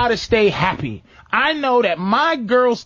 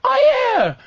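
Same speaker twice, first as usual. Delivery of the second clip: mock girlfriend voice; 10 semitones higher than usual. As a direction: mimic her proud, eager reply. Girlfriend quoted in retold argument.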